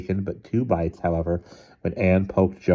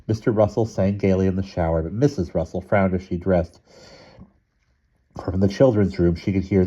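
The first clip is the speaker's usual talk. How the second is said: plain reading voice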